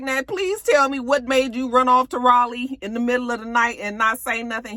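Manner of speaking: imitating a demanding Tamara; demanding wife.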